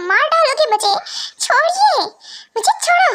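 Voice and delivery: begs him to release her neck, voice climbing into a shout; panicked pleading. Acted. Being grabbed by the neck. (गला छूटने के लिए घबराकर चिल्लाती हुई)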